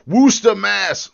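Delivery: loud, high mock Massachusetts accent; hammed-up local Massachusetts pronunciation. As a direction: say it like a Massachusetts native. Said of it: "my sister-in-law is from Mass".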